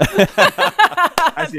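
loud laugh on the words. Real, not acted. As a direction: laugh through it. Laughing mid-story.

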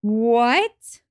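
shocked. Real, not acted. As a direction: blurt it out in shock. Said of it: "see [name]'s shock right now".

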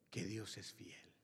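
slow, low whisper; hushed confidential aside. Acted. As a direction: lean in and whisper it.

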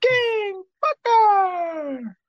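very high, long-held squealing call; over-the-top mock ring introduction; hammed-up announcer echo. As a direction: stretch each word right out, way up high.

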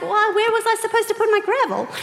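high, indignant protest; hammed-up indignant husband. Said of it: "He was most indignant".

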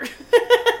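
big high cackle; loud laugh. Real, not acted. Laughing at own story.

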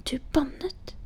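hushed shocked whisper; awed whisper. A narrator's reading. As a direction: breathe it out, scandalised. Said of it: "hvisket Sofia".

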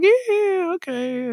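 very high, drawn-out retold reaction; mock reaction squeal. Fan recalls hearing of the show, mock high squeal. Acting out first reaction to announcement.

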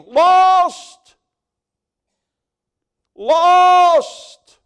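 a very loud, very high anguished scream; screaming lost souls. Acted. Preacher voicing the damned as they fall, screaming. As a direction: howl it like someone going over the falls.

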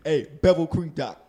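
loud, slow mock ad read; mock ad shout. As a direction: belt it like a cheesy ad.

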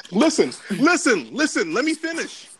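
loud insistent listen, rising high; loud sharp voice. Real, not acted. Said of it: "Why you yelling?".

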